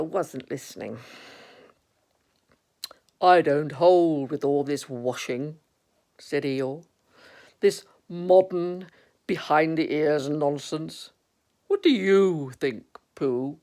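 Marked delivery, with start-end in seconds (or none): 3.2-5.6 slow, disapproving donkey; Eeyore. Acted. Eeyore objecting to all this washing, slow grumbling. 7.6-13.6 slow, disapproving donkey; Eeyore. Acted. Eeyore objecting to all this washing, slow grumbling.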